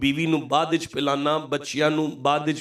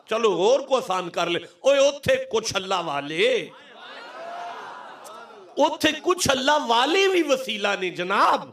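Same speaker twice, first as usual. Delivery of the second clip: climactic preaching; 6 semitones higher than usual. Real, not acted. Preacher driving his point home in simpler words, climactic. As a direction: proclaim it for the crowd to roar back.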